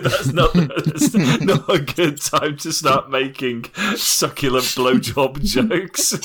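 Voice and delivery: laughing through the words; can barely talk for laughing. Real, not acted. Laughing while scolding.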